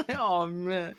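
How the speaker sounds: ordinary delivery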